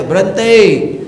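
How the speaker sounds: high, loud mock cry to stop; hammed-up shriek of Berhenti; mock shout. Shown as a demonstration. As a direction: yell Berhenti in pretend alarm. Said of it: "teriak aja".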